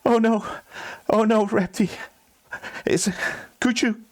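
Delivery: high, halting acted crying; whimpering, broken plea; tearful panic. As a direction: beg for help through mock sobs. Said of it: "he starts crying, and he's in panic".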